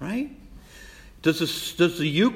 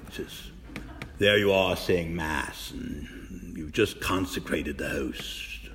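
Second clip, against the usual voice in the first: imitating Father Quinn; 7 semitones lower, 5 dB quieter than usual. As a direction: lightly mimic the old teacher, slowing down. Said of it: "he always spoke like this".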